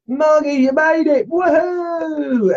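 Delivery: high, loud whooping welcome; excited cheer. Real, not acted. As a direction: cheer their arrival in a high squeal. Cheering a viewer's arrival.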